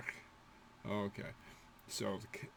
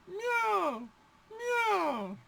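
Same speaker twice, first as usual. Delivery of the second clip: high cat meow. Shown as a demonstration. Teacher meowing for the word for cat.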